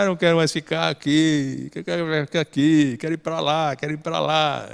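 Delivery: leve voz de criança contrariada, mais baixa; criança manhosa. Retold or imitated. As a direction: imita de leve uma criança chorona que quer ir embora. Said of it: "a criança já fala". (criança teimosa imitada de leve pedindo para ir pra lá)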